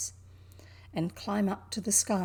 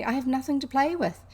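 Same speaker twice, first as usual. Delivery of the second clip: put-on child Mary; 6 semitones higher, 5 dB louder than usual. Sulky child Mary answers maid.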